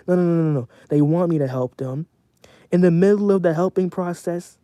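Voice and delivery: usual speaking voice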